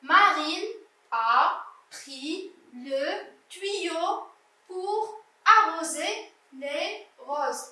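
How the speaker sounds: very slow, word-by-word reading; teacher rereading slowly with clear gaps; slow model reading. Shown as a demonstration. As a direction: space out each word for a young learner. Rereads the sentence for pupils.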